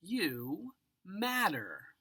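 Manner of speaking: stretched slowly; slow and tender. A narrator's reading. Reading the simplest powerful words, slow and tender. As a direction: say it like a gentle promise.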